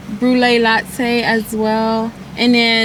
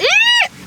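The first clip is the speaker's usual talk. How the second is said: very high little squeal of delight; excited squeal. Real, not acted. Tasting the new drink, high excited squeal. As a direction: squeal like the drink thrilled you.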